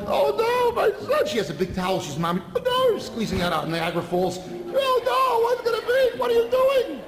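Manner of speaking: high mock-wailing mother; hammed-up sobbing lament; crying mother. Mother sees her son taken, high hammed-up wailing. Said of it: "She's crying".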